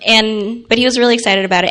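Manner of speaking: normal talking voice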